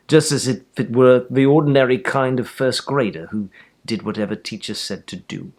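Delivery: plain reading voice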